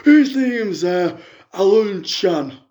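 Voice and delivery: a lightly put-on middle-aged fan on the phone; a fan phoning in with a sob story, lightly voiced; [name] caller voice. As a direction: play the hard-up caller, chatty and woeful. [name] tells her news.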